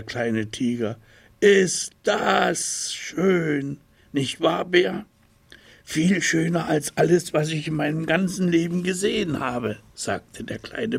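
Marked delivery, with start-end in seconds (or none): 1.4-5.1 put-on higher, slow, marvelling child voice; awed little tiger. A narrator's reading. Little tiger marvelling at the view, high awed voice. 5.8-9.8 a raised, lightly voiced child bear; eager bear, hurrying the end; little bear voice. A narrator's reading. Little bear agreeing with tiger, lightly raised voice.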